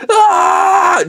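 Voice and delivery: high, loud drawn-out scream; retold scream. Speaker screaming back at the wives, long shriek. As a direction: answer with a long, piercing scream. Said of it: "I remember screaming".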